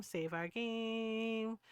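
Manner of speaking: very slow, stretched words; drawn out. Pretend. Reminding herself to save the game, playfully drawn out. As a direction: hold GAME long and playfully.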